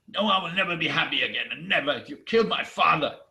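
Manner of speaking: fast, forceful outburst landing loud on the accusation; angry refusal. Acted. Grieving giantess rejects Odin's offer, angry refusal. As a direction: fire back, refusing to be consoled.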